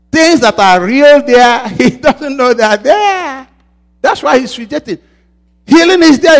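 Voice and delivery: high, loud, driving preacher's cadence; fervent preaching. Acted. Pastor urging the congregation, fervent loud preaching. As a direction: hammer each line home to the church.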